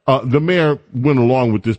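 ordinary talk, nothing marked

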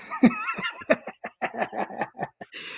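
unvoiced, high-pitched laughing fit; high breathy laugh. Real, not acted. Host cracks up at the Hulk Hogan joke.

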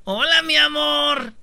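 high, drawn-out sweet-talk hello; mock lover's greeting. The other girl playing along greets him, high drawn-out mock greeting. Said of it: "entrándole al juego, dijo".

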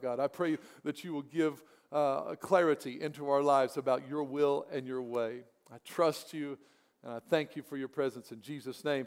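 soft, earnest prayer to God. Acted. Pastor praying softly before the sermon, hushed prayer voice. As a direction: pray it quietly and humbly.

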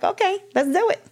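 high, sugary fake yes; mock sweet agreement. To her lover asking her to divorce, sugary mock agreement. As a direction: agree far too sweetly, pitch way up.

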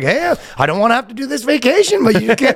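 high mock Canadian bickering; grudging put-on Canadian accent. Feuding pair forced to share a vacation, Canadian accent. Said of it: "the most Canadian interaction".

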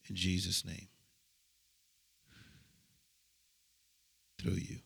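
low, soft intimate ministering voice; healing ministry prayer. Acted. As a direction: pray over her quietly and firmly. Healing prayer over a woman.

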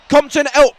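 loud, high commentator's shout; mock match commentary.

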